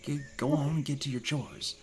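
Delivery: soft, half-whispered scolding mother; farm mother. Acted. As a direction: hush the boy off to his chores. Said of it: "mother says".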